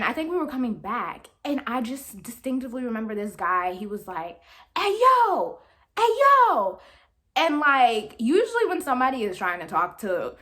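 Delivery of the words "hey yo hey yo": high, loud retold catcall; calling out. Man hailing three friends walking past, calling out loudly. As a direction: holler for attention in two bursts. Stranger calling out to passing women.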